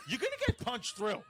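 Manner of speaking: talking through laughter; laughing. Real, not acted. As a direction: giggle the words out. Laughing comment.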